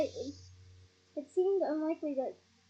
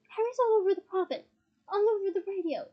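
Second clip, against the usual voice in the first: raised, louder insistence; bellowing argument; 5 semitones higher, 5 dB louder than usual. A narrator's reading. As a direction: argue back forcefully. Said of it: "bellowed Ron".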